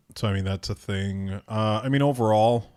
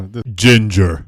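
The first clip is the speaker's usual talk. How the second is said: laughing; 10 dB louder, slower than usual. Retold or imitated.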